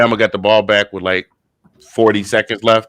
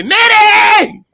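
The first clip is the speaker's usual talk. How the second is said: very high, loud shriek of a name; frantic cry after someone running away; impersonated yell; 6 dB louder than usual. Retold or imitated. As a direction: shriek the name like a chase in a movie. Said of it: "I was running like Sealy was running after Nettie".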